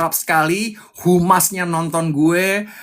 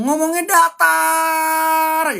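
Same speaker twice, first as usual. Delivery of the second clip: much higher, slow mock drone; mocking flat delivery; 10 semitones higher, much slower than usual. Coach mocking officials who speak flatly, slow mock drone. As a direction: drag it out to mock dull officials.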